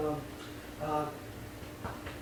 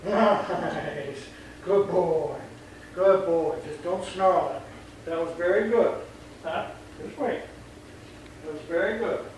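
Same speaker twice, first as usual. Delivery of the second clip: dog praise; 4 dB quieter than usual. Real, not acted. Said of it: "praising the dog".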